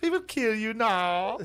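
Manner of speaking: very high, slow comic threat; silly high-voiced radio menace; mock Nazi captain. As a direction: draw out a hammed-up villain threat. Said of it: "getting on their radio and being like".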